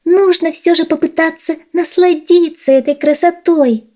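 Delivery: high squeaky little mouse; tiny high mouse voice. Acted.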